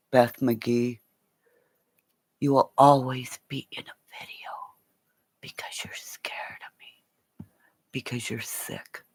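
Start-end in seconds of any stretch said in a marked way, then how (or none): 2.4-9.0 hushed threatening whisper, no voice; menacing whisper. Real, not acted. Streamer taunting Beth McGee in a menacing whisper. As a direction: breathe it at her like a threat.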